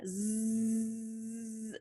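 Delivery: long buzzing "Zzz"; voiced Z. Shown as a demonstration. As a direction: hold "Zzz" with the voice on, like a bee. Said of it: "you turn your voice on".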